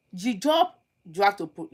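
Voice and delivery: ordinary talk, nothing marked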